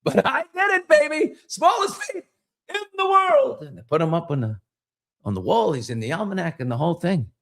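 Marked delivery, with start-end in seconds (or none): 0.0-3.6 high, gloating bar brag; triumphant boast. Acted. Joke guy back from records office, high gloating brag. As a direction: crow about the win. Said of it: "He's like".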